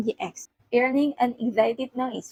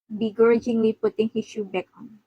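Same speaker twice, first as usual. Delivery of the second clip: plain reading voice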